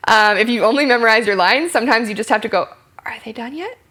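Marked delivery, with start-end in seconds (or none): 3.0-3.8 very soft, higher-pitched aside; hushed inner thought. Acted. Actor waiting for the partner to finish, hushed. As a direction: wonder it under your breath.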